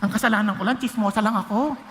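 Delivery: low, mocking gossip excusing herself; imitating a churchgoer. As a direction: play the defensive churchgoer with a laugh. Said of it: "isipin nyo".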